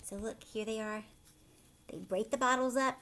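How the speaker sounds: usual speaking voice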